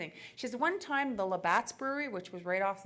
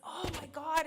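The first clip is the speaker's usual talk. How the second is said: mock horror; 7 semitones higher than usual. Crowd freaks out at the rat.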